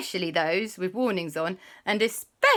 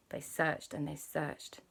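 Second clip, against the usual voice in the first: usual speaking voice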